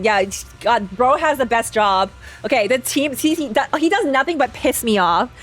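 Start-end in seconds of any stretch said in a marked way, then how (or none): none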